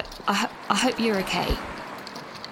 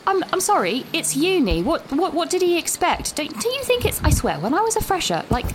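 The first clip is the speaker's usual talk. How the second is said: higher, exasperated rant; 8 semitones higher than usual. Real, not acted. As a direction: vent your disbelief. Exasperated rant about fresher.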